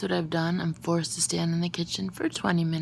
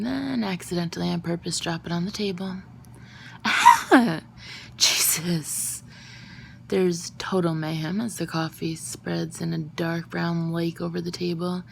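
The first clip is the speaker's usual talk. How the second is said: ordinary delivery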